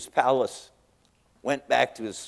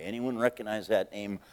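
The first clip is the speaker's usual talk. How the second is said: normal talking voice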